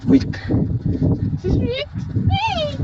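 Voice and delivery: high panicked squeal; scared horror game streamer. Real, not acted. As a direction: yelp it out in fright. Panicked by pursuer.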